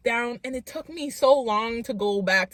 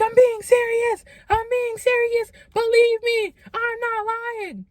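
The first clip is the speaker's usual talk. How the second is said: pleading; 12 semitones higher than usual. Retold or imitated. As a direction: beg them to believe you. Begging parents to believe.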